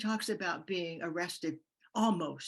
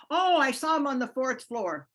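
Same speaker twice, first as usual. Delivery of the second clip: bright decoy call; 6 semitones higher, 9 dB louder than usual. Retold or imitated. Misleading the Nazis in disguise.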